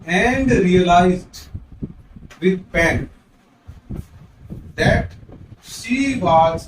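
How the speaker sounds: slow, drawn-out recitation; a slow careful reading of the poem line. Shown as a demonstration. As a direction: read each phrase slowly with pauses. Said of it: "इस line को हम इस पहले वाली line से compare करते हैं".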